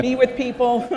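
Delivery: high, loud, through laughter; amused, voice lifted by a laugh. Real, not acted. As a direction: laugh while talking. Host jokes about dressing up.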